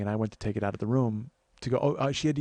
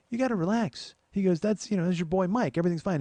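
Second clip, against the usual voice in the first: high soothing reassurance; 8 semitones higher than usual. Retold or imitated. Dan soothes agitated mom.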